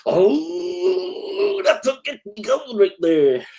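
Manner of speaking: big high yelp of delight, then gushing; light up at the sight of it. Real, not acted. Admiring the guest's Paddy's Day shirt, delighted high yelp.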